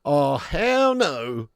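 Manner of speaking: very slow, stretched out; rejecting a milk-first viewer, very slow; drawn-out refusal. Pretend. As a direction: drag every word of the refusal. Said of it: "I'm gonna have to go ahead and say".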